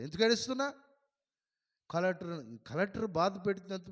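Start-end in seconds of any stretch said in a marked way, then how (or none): 0.0-0.7 sharp loud scolding, rising higher at the end; scolding rebuke. Retold or imitated. Pastor scolding a weeping woman, loud rebuke. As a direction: rebuke her for crying here.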